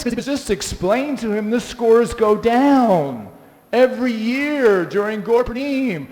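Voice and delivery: normal talking voice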